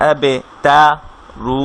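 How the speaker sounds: আবে তা read, pause, then রু as a separate piece; syllables split apart by a pause; broken reading. Shown as a demonstration. Teacher shows students the wrong, broken reading. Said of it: "এভাবে করে আমরা পড়বো না".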